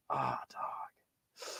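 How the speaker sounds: breathy whispered letdown; reacting to the pink Godzilla, whispered in dismay; disappointed whisper. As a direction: whisper it, deflated. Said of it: "I'm like".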